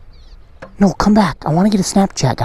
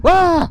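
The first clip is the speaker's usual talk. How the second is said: high imitated animal yelp; prairie dog cry imitation; 10 semitones higher than usual. Vlogger coaxing the prairie dog to copy, high yelp.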